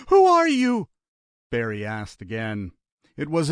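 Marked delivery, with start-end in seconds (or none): 0.1-0.9 high, loud tearful cry; frantic, near-sobbing question; crying panic. A narrator's reading. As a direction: beg for an answer through tears. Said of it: "He was crying".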